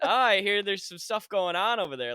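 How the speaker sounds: high, loud worried-mom imitation; Laura's worried voice. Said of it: "the Laura just worried voice that she gets".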